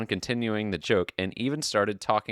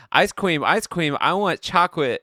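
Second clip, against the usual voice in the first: put-on higher, louder excited kid yelling; mock kid yell; 9 semitones higher, 6 dB louder than usual. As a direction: bounce on it like a kid begging for ice cream.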